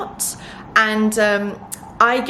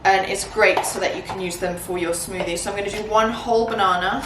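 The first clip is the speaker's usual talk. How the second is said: ordinary talk, nothing marked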